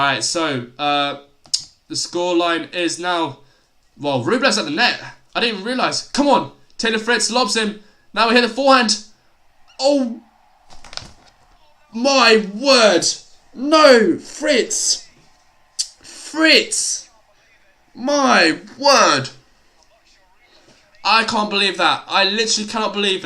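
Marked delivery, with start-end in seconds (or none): 4.0-10.2 live play-by-play. Real, not acted. 11.9-19.3 stunned disbelief. Real, not acted. Disbelief at a missed shot.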